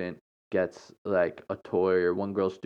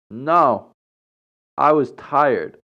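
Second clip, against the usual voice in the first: louder, slowed, curt retort; tough, blunt; 5 semitones higher, 6 dB louder, much slower than usual. Retold or imitated. She tells the churchgoer she skipped because she was tired, tough and blunt. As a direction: play it tough and unbothered. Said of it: "I'm trying to sound tough right now".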